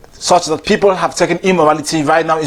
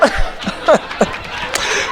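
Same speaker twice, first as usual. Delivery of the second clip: breathy laugh; 6 semitones higher, 5 dB quieter than usual. Real, not acted. Speaker laughs at own joke.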